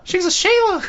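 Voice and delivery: squeaky mock-Australian dog voice; falsetto. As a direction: echo it in a very high falsetto. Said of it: "we also try to do it in falsetto".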